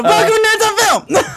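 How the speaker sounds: high laughing intro line. Real, not acted. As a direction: squeak it out through laughter. Laughing through the show intro.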